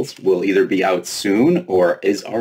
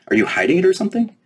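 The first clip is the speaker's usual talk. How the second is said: quick high teasing; 13 semitones higher than usual. Acted. Fairy teasingly probes Lena.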